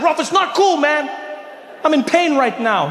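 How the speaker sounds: high-pitched, exasperated complaint; upset protest. Acted. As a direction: plead with him to stop joking. Hurt protest to teasing friend.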